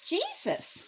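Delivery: high parrot voice. Acted. Parrot telling the burglar its name, high squawk. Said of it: "the parrot said".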